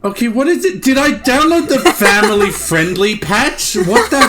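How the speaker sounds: high, loud exclaiming; shocked the swears got censored; startled outrage. Real, not acted. Gamer reacting to the censor patch, loud high shock.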